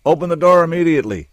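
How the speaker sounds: loud, high, fast command; loud order. Acted. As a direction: pound the order through the door. Soldier shouting at the door.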